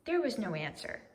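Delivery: plain reading voice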